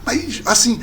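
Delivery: normal talking voice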